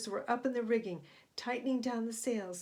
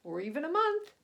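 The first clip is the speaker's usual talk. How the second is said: lightly voiced captain; 5 semitones higher, 5 dB louder than usual. A narrator's reading. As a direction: let each guess hang like a skipper. Captain muses on waiting.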